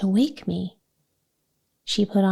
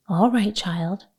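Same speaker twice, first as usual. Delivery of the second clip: usual speaking voice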